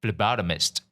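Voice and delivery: ordinary delivery, nothing marked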